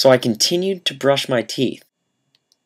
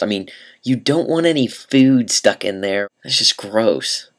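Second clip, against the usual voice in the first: normal talking voice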